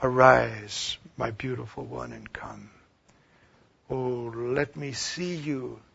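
a hint of tender pleading, soft and slow; tender Jesus voice. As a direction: call gently, as a lover longing. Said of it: "Can you hear Jesus saying that to you".

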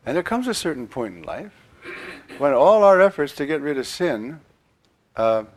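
usual speaking voice

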